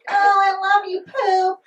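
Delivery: high, sappy sweet-talk to a lover; mock lovey-dovey. Couple cooing at each other, mock lovey-dovey. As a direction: coo it like a smitten partner. Mocking couples' pet names.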